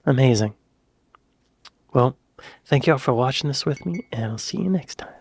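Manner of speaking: usual speaking voice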